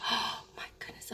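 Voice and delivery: overcome, whispering; awed whisper. Real, not acted. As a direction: gasp then hushed whisper. Moved by a picture.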